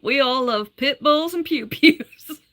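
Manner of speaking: exaggerated Southern accent, slow and loud. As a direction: read it like a Southern good ol' boy. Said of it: "read that in a Southern accent".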